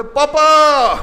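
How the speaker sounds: high, loud call; tattling brother. Retold or imitated. Little brother calling dad to tell on big brother, high call. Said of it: "और बोले".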